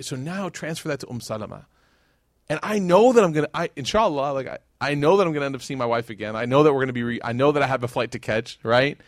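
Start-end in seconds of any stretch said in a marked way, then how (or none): none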